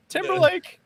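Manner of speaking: very high laughing squeal. Acted. Guest comparing himself to [name], laughing squeal.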